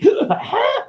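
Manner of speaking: sharp high gasp of laughter; high laughing gasp. Real, not acted. Cracking up at own crow joke, high gasp.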